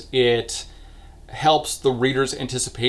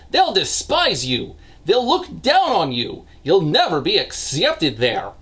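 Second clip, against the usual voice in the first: high, hammed-up Eastern European accent; terrible Eastern European accent; 6 semitones higher than usual. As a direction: plead in a badly faked Eastern European accent. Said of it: "That is the worst Eastern European accent ever recorded".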